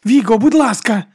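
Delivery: high, loud imploring; urgent plea. A narrator's reading. Monk dragging Viga off the man, urgent plea. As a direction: beg Viga to stop.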